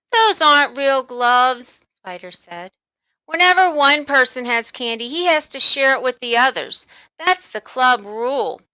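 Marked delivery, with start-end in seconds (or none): 0.1-1.9 scoffing. A narrator's reading. Bully scoffing. 3.3-8.6 bully voice. A narrator's reading. Bully laying down rule.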